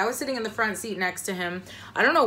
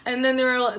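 ordinary talk, nothing marked

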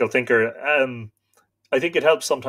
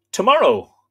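raised, higher declaration; defiant resolve; 8 semitones higher, 9 dB louder than usual. Acted. Stag answering the wolves, mild defiant call. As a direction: announce your fate to the wolves. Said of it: "I said".

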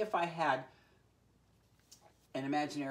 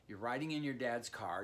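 usual speaking voice

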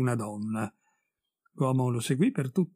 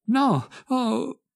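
sharp, high cry of fright; frightened cry; 10 semitones higher, 4 dB louder than usual. Acted. Wife recoils from the veil, frightened high cry. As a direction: gasp it out, scared.

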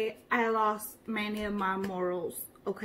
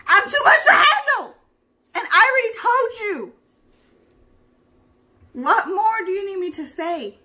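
much higher, very loud diva outburst; diva shriek; 9 semitones higher, 10 dB louder than usual. Acted. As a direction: blast it like a wounded diva.

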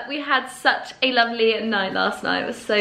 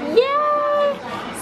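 high excited yay; excited cheer. Real, not acted. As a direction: squeal it with delight.

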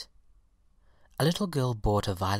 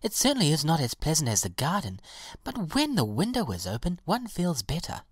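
higher-pitched, reasoning reassurance; reassuring violet; 6 semitones higher than usual. A narrator's reading. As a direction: look on the good side, voice pitched up.

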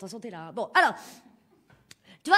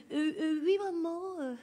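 mock prim son voice; lightly voiced prissy son; a touch of affected politeness; son putting on airs; 4 semitones higher, slower than usual. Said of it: "Il se donne un petit air".

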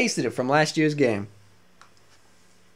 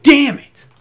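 loud and higher, blowing up in frustration; furious shout; 7 semitones higher, 9 dB louder than usual. Real, not acted. Gamer venting at the game's missing match, furious shout. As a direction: bark out a real angry curse. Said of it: "I am angry".

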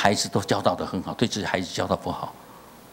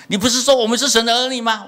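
higher, louder protest; indignant son; 12 semitones higher, 6 dB louder than usual. Retold or imitated. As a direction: fire the question back in a higher, louder voice. Son retorts to father, retold.

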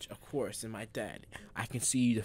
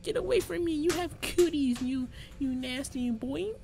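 father squealing in a much higher voice; squeaky father voice; 13 semitones higher, 3 dB louder than usual. Retold or imitated. Mocking his father pushing him away, in a high squeal. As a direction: squeal it like a grossed-out kid. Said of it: "And my father's like".

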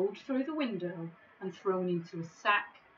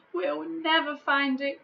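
despairing sigh; 8 semitones higher, 6 dB louder than usual. Acted. Despairing giant.

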